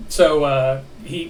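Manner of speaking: ordinary delivery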